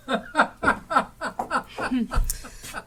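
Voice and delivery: high, loud wheezing cackle of three rogues; loud cackling laugh. Acted. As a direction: cackle together, gleeful and coarse.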